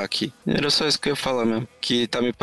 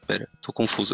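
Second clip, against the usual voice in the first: usual speaking voice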